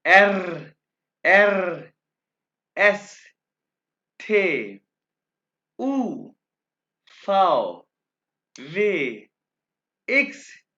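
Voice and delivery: each German letter called out loud and clear; slow letter-by-letter demo. Shown as a demonstration. Teacher reciting the alphabet to students, loud and clear. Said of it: "अब मैं इसको दोबारा pronounce करूंगा".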